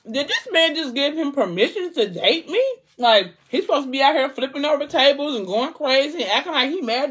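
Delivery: high, incredulous rant; outraged disbelief. Retold or imitated.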